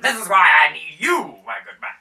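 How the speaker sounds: high, loud noble voice; a lord recruiting heroes; hammed-up grand baron. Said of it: "the Baron pipes in".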